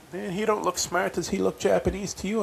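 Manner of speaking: imitating friend mocking; mocking high voice. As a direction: jeer it up high.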